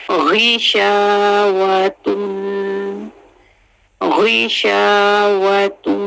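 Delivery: गिशावतुन slow, गी with a throaty gh; tajweed demo. Shown as a demonstration. Teacher modelling गिशावतुन slowly for students. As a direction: draw out गिशावतुन. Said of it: "गे नहीं पढ़ेंगे, गी पढ़ेंगे".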